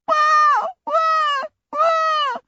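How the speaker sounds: put-on very high child's tantrum wail; hammed-up screaming cry of a little girl; loud bawling wail. A narrator's reading. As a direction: bawl like a furious child. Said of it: "she vociferated".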